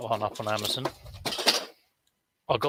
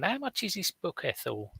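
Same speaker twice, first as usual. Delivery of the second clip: church helper asking; 7 semitones higher, 5 dB quieter than usual. Retold or imitated. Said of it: "she was asking someone".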